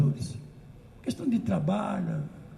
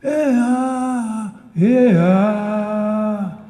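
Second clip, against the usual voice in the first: full-voiced, much louder call; loud shout; 2 semitones higher, 8 dB louder, much slower than usual. Shown as a demonstration. The teller showing the audience the jongo call, loud shout. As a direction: shout it out to stop the whole crowd. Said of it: "eu grito".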